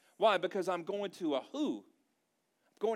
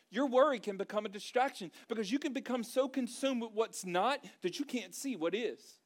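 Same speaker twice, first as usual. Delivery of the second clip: normal talking voice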